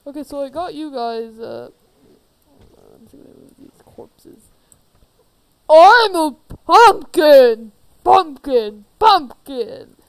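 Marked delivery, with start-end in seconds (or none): 2.9-4.5 soft whispered aside to the audience. Real, not acted. Aside to viewers. 5.7-10.0 slow, loud monster boom; booming pumpkin voice. Acted. Pumpkin creature announces itself.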